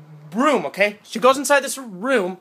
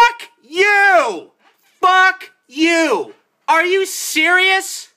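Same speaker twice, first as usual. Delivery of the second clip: loud, high, drawn-out angry shout; outraged yelling; 7 semitones higher, 4 dB louder, much slower than usual. Real, not acted. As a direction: yell in disbelief at the movie. Rants at the film's ending.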